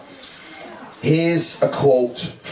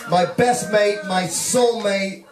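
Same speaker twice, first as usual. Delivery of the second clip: raised, high-pitched call to the room; heartfelt toast, projected high over the crowd; wedding toast; 7 semitones higher than usual. Real, not acted. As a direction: propose a toast, calling out to all the guests. Toast closing a wedding speech.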